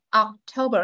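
October with TO standing out and the rest reduced; ocTOber, lighter on the outer syllables; strong middle stress. Shown as a demonstration. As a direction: make TO the peak of October. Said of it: "điểm nhấn mình phải lên mạnh".

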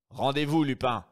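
stern command. Acted. Brigadier Folenfant aiming at Lupin, firm loud order. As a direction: order him to give up.

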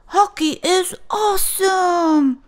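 high, gleeful cheer; mock roar. Clark cheering at reef hockey, high gleeful. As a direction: shout it like you own the rink. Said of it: "he roared".

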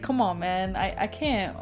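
ordinary delivery

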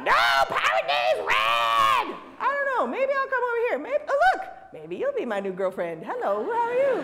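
hammed-up cartoon voice; squeaky fan flirting; high mock pirate fan.

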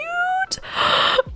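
high breathless gasp of delight. Real, not acted. Gamer gasping at her sims' new romance, overjoyed. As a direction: gasp like it's too cute to bear. Squealing over prom girlfriend news.

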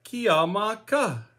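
Kiamaka as kee-ah-mah-KA, slow syllables; teaching pronunciation. Shown as a demonstration. Teacher showing how to ask what about you, Kiamaka as slow kee-ah-mah-KA. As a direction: model "Kiamaka" slowly, with a crisp final KA. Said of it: "Give that a try with me".

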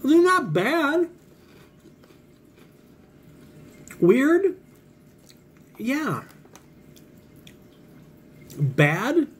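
talking between bites; chewing while talking. Real, not acted. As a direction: mouth partly full. Seen 2: Puts snack in mouth at -4s; lips closed, jaw working while speaking.

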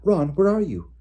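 high, loud worried call; anxious calling. A narrator's reading. Hermione searching for Ron in the dark, anxious. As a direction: call out for a friend in alarm. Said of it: "said Hermione anxiously".

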